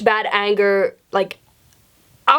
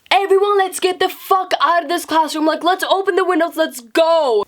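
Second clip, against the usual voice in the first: high, raised call to the class; rallying call; 7 semitones higher than usual. Retold or imitated. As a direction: rouse the whole class to climb out the windows. Standing on chair rallying class.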